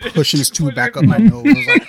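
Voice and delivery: can't keep from laughing as he tells it. Real, not acted. Telling friends about the nurse and the tube, laughing.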